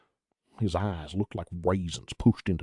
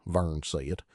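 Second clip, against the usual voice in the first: ordinary delivery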